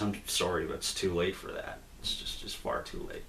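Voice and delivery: soft, low, understated sorrow; quietly regretful, trailing away to almost nothing; sad, near tears. Retold or imitated. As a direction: voice a mildly sad, resigned apology. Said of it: "it almost looks like he's crying".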